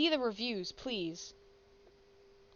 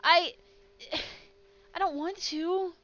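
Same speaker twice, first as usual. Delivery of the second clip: pained; 7 semitones higher, 5 dB louder than usual. Real, not acted. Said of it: "Don't hurt Momo".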